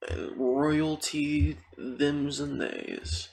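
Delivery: high, drawn-out mock-grand praise; playful hype. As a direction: hail the listeners with stretched high notes. Playfully hailing the listeners.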